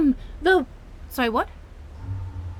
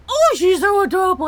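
a lightly voiced, louder gush; mock gushing onlooker; 3 semitones higher, 6 dB louder than usual. Otter girl mimicking the crowd who called her adorable. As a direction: parody the people who doted on her. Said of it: "All they saw was the cute".

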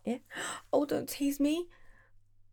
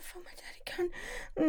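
much softer, higher unvoiced whisper; hushed high whisper; whispered demand; 7 semitones higher, 7 dB quieter than usual. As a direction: hiss it breathily.

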